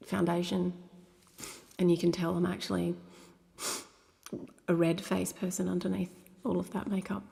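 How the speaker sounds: ordinary talk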